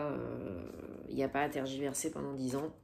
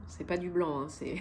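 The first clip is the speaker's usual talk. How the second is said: ordinary delivery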